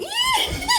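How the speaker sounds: high, piercing squealed yee; retold scream. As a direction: re-enact a long high-pitched shriek. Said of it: "just the way I screamed".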